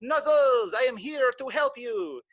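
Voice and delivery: the brave squirrel offers help; eager squirrel voice. Acted. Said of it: "he said".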